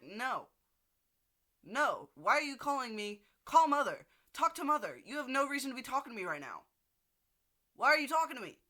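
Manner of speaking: high, loud outburst at dad, fast; mock telling dad off; exasperated yelling. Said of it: "I was like".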